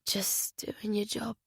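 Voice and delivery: whispered, slow, hurt echo; sad whisper. Acted. Butterfly to Jack, echoing him, hurt whisper. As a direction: let the words fall out in a wounded whisper. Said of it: "Butterfly said sadly".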